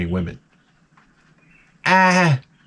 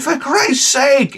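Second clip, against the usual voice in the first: higher, very slow for Christ's sake; exasperated; 11 semitones higher, much slower than usual. Retold or imitated. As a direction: drag it out in disbelief. Inner exasperation.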